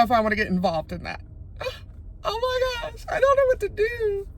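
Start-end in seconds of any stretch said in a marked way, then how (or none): 1.2-2.2 startled gasp. Real, not acted. Police heading to Walmart. 2.2-4.3 scared. Real, not acted. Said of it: "I'm so scared".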